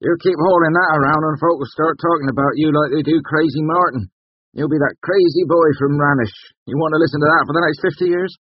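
high, loud rustic scolding; grousing. A narrator's reading. Carter warning the prentice about gossip, grousing. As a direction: nag the boy with loud complaint. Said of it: "Carter groused".

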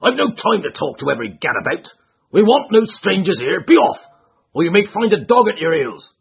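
angry trainer. Acted. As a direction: drive them off in a rage.

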